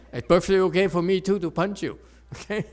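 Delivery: laughing through a quick joke; chuckling at his own joke. Real, not acted. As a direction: giggle through it, a bit fast. Joke about punching back.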